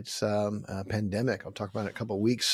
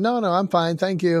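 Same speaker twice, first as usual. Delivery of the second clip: brusque refusal; 6 semitones higher, 6 dB louder than usual. Acted. Young guy refusing help.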